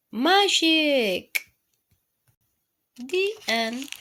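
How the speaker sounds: put-on hushed storybook voice for kids; reading to children. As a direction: read it gently to a little one, taking your time. Reading a picture book aloud.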